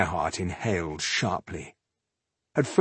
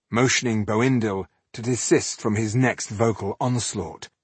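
plain reading voice

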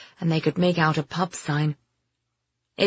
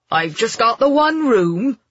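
higher, louder Somerset landlord; strong Somerset accent; 6 semitones higher, 5 dB louder than usual. A narrator's reading. Landlord offers his only room, in Somerset accent. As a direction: answer as a broad Somerset innkeeper. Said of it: "in a strong Somerset accent".

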